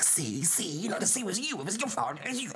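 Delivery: high, hushed blaming squabble; bickering kids. Retold or imitated. Kids blaming each other, high-pitched bickering. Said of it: "we'd be like".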